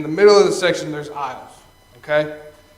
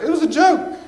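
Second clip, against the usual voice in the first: jumps much higher, pleading it was a joke; defensive high protest; 8 semitones higher than usual. Retold or imitated. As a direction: squeak out the excuse. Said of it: "And I was like".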